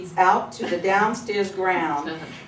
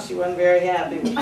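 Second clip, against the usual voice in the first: ordinary delivery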